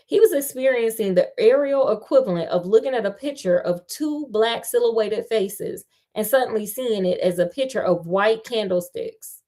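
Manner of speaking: usual speaking voice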